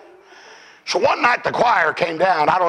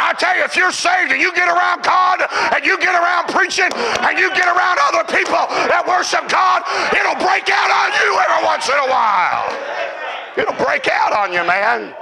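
raised, high, shouting preacher cadence; preaching at full force; 12 semitones higher than usual. Acted. Preacher stirring the congregation about worship, full force.

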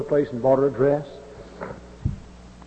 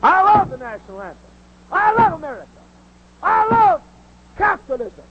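impassioned preaching; 9 semitones higher than usual. Acted. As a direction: shout your love of country from the pulpit.

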